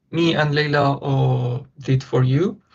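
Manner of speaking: normal talking voice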